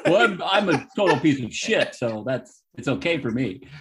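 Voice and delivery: laughing through a mock self-own; half-laughing, hammed-up confession. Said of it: "You're like".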